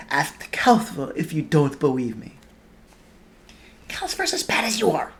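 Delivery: whispered. Acted. Hushed argument behind sofa.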